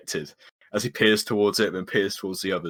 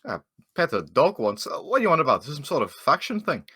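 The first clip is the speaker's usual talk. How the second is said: high-swooping bewildered character voice; confused in-character questioning; puzzled dwarf; 8 semitones higher, 4 dB louder than usual. Acted. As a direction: ask what on earth this is about.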